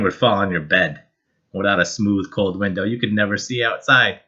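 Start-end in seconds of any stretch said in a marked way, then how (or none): none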